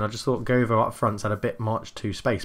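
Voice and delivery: ordinary talk, nothing marked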